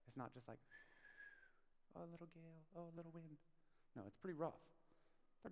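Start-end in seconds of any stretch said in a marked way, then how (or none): 1.9-3.4 small, soft voice, much quieter; mock dainty understatement. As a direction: play down the danger in a tiny soft voice. Mocks a mild storm, disciples unworried.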